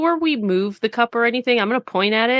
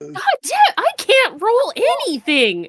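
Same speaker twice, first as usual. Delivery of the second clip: anguished wail; 12 semitones higher, 3 dB louder, slower than usual. Real, not acted. Bad dice roll frustration.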